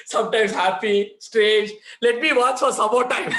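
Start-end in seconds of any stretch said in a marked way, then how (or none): none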